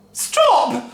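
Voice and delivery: high, loud cry. A narrator's reading. Wife crying out to the shrinking Grandma. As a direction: cry out to stop it. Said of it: "cried Missus Cranky".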